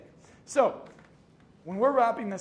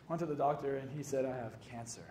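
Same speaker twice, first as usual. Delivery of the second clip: lower, softening as it ends; grave bad news; 5 semitones lower, 5 dB quieter than usual. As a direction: imitate a patient quietly breaking bad news. Retold patient breaking cancer diagnosis.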